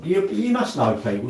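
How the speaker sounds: normal talking voice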